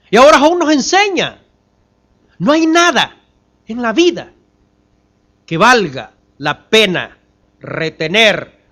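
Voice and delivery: high, forceful pulpit voice; impassioned preaching. Real, not acted. Preacher tells the brethren nothing material is worth keeping, fervently. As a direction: drive the lesson home loudly, phrase by phrase.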